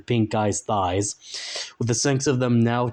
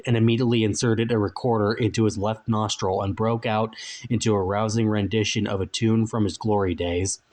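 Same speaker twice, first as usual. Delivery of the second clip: ordinary delivery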